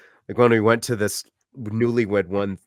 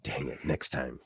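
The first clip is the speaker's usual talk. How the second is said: quiet resigned; 9 semitones lower, 10 dB quieter than usual. Real, not acted. Resigned after missing the chance.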